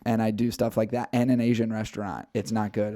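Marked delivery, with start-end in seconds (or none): none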